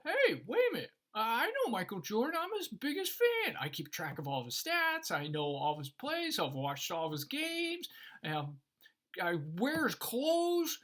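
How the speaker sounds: loud, high-pitched bragging protest; acted indignation, pitch leaping up; insistent fan. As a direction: argue your case to the doorman as the biggest fan. Acting out fan pleading with bouncer.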